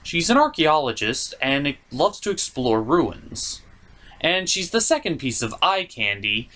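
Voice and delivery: ordinary talk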